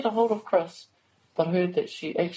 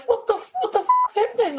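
shocked, much higher and louder imitation; alarmed; 4 dB louder than usual. [name] mimics sister-in-law's shocked reaction, high and loud. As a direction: blurt it in sudden alarm.

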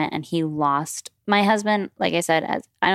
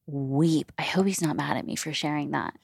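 ordinary talk

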